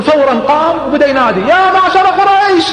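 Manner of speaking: shouting to the tribe; calling out. Retold or imitated. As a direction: call across the square. Jamil calls out to Quraysh.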